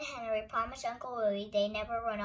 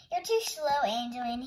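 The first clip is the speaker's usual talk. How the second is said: teasing; 5 dB louder than usual. A narrator's reading. Henry to Angelina, lagging on the climb, teasing. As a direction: tease her for lagging behind. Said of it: "he teased".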